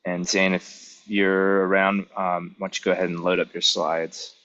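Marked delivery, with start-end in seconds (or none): none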